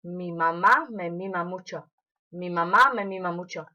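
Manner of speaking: "Mi mamá me mima mucho" rattled off quickly; fast tongue twister. Shown as a demonstration. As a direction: race through it as fast as you can. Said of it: "How fast can you do that?".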